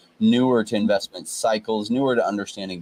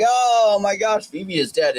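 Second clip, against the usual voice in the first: mock alarm; 11 semitones higher, 3 dB louder than usual. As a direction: imitate people wailing in alarm. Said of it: "people are like".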